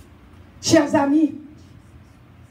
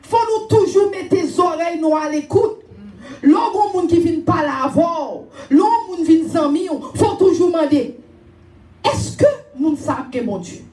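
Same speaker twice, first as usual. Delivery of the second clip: earnest exhortation; 3 semitones higher than usual. Real, not acted. Exhorting the congregation.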